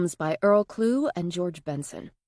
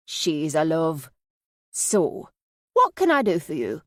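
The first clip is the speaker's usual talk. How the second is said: lightly voiced Yorkshire accent, slow at first; Minnie; 3 dB louder, slower than usual. A narrator's reading. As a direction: linger fondly, then offer help, in light Yorkshire. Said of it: "Minnie's Yorkshire accent".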